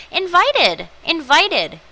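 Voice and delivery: invited punched high and loud; invited said twice, loud and rising in outrage; stressed invited. Shown as a demonstration. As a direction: hammer the word invited in disbelief. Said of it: "you read that right".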